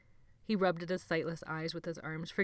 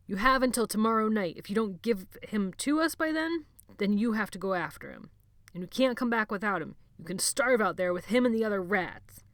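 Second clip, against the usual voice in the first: louder menacing threat; threatening ultimatum; 4 semitones higher, 6 dB louder than usual. A narrator's reading. Sebastian threatening Jonathan, loud and menacing. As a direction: lay down the threat.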